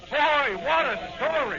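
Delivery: loud, high and drawn-out delight; thrilled. Acted. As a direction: savour the big scoop. Reporter thrilled by scoop.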